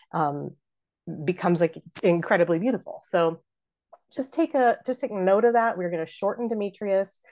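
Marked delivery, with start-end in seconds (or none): none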